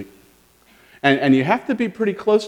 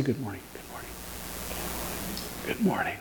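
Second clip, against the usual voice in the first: soft whispered greeting; whispered good morning; 5 semitones lower, 14 dB quieter, faster than usual. Shown as a demonstration. Pastor modelling a whispered good morning, whispering. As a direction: breathe the greeting out quietly. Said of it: "Just say, say".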